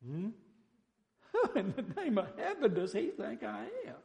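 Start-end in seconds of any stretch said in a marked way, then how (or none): 1.3-4.0 high, indignant outburst as Naaman; wroth, angry Naaman. Acted. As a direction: act out wounded pride, pitched high. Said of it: "Wroth, angry".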